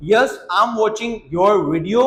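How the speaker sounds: slow, careful answer for learners; slow model answer. Shown as a demonstration. Teacher modelling the English answer slowly. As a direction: stretch each word out. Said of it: "हाँ, मैं आपका video देख रहा हूँ".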